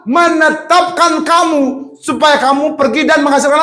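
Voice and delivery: slow, high, drawn-out proclamation; proclaiming scripture. Acted. Preacher proclaiming the verse to the congregation, slow and high. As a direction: stretch each word as you proclaim the verse.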